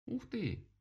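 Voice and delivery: high rabbit voice mid-yawn. Acted. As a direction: exclaim through a yawn. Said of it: "сказал кролик, зевая".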